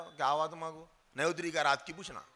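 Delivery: tossed off under the breath, fast; quiet quick ask. Shown as a demonstration. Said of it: "ऐसे पूछना".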